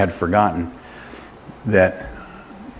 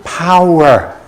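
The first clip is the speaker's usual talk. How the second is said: higher, punchy single-word exhortation; urging more power; 6 semitones higher, 4 dB louder than usual. Shown as a demonstration. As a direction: throw the word out to rouse the room. Urges group to be louder.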